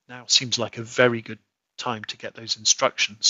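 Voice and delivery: ordinary delivery, nothing marked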